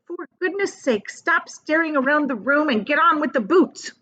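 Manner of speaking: high, fussy scolding from the picky centipede. Acted. Centipede scolding James over his boots, high and fussy. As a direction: nag him to hurry with the boots. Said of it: "the centipede said".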